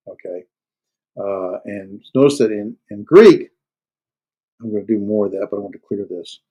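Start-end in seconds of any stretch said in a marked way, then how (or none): 2.9-3.5 "Greek" hit higher and louder; stress on Greek. Shown as a demonstration. Teacher stressing Greek to his students, loud emphasis. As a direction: punch the word "Greek" up and loud. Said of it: "I'm really emphasizing it hard".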